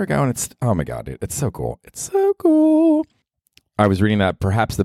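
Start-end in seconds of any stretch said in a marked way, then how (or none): 1.8-3.1 much higher, slow, louder gushing; hammed-up high excitement; giddy squeal. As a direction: squeal it like a thrilled kid.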